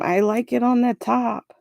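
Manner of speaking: yelled from the next room; called out from far off; from another room. Real, not acted. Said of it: "She's yelling from the other room".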